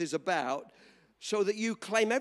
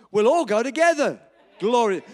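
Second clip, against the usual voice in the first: preaching cry; 8 dB louder than usual. Acted. Preacher exclaims to congregation.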